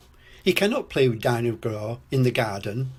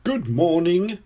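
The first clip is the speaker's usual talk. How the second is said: high, loud cheery shopkeeper greeting; beaming greeting; 6 semitones higher, 5 dB louder than usual. A narrator's reading. Mister Fox greets customers in his shop, beaming. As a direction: sing out a cheery welcome. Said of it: "beams Mister Fox".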